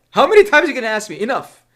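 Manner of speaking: rapid, high, loud mock complaint; exasperated. Human tired of being questioned, exasperated outburst. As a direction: fire it off as if fed up. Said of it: "If you as a human being were being questioned like this".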